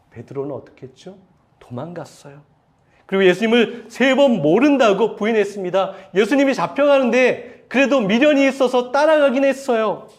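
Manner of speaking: normal talking voice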